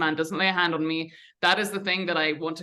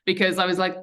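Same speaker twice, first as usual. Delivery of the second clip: usual speaking voice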